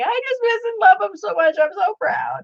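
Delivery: high, sobby retelling of crying; mock tearful. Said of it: "I burst out in tears".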